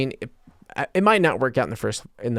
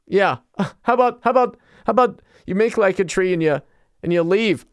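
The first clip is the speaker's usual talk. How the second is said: high, hammed-up comedian voice doing a bit; imitating a comedian at the Comedy Cellar for a laugh, high and hammy; mock stand-up comic; 6 semitones higher than usual. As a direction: play a corny club comic working the crowd, pitched up. Mimicking a stand-up comic clip.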